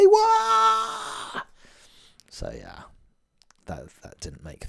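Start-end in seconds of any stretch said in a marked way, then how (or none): 0.0-1.4 very high cheering crowd noise; crowd roar. Pretend. Crowd cheer after announcement.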